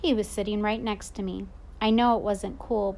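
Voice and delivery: usual speaking voice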